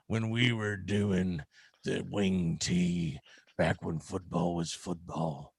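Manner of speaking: put-on slow, low creepy old man. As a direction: drag it out like a lonely old fan. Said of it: "you got that creepy old man voice going".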